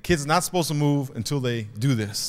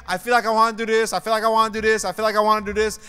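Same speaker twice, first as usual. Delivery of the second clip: higher, louder, quick imitation of an eager dreamer; eager repeated wish; 9 semitones higher, 5 dB louder, much faster than usual. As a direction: imitate someone gushing, faster and higher. Voices eager people repeating themselves.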